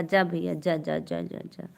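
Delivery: ordinary delivery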